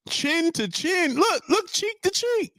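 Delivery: high and slow, savoring each word; amazed disbelief. Real, not acted. Vlogger marvelling at the hug, high and slow. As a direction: stretch it out in disbelief.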